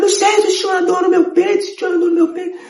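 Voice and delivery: voz bem aguda e aflita; aflito. Retold or imitated. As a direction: explicar a dor em pânico. Filho em pânico com dor. (filho aflito explicando a dor à mãe)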